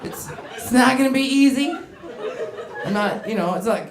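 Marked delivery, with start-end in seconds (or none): none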